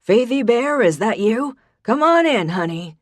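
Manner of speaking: beaming grandpa calling his granddaughter. A narrator's reading. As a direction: call her in, cheery. Grandpa calls out to Faith.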